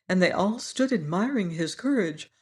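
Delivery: ordinary delivery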